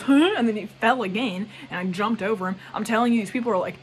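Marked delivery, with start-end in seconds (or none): 0.0-0.3 sharp, high and loud huh; a mock startled skier. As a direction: blurt it like someone caught off guard. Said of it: "he goes".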